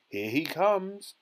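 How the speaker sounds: high, drawn-out announcing call; excited yell. A narrator's reading. Wonka announces the boy appearing on screen, yelling. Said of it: "yelled Mister Wonka".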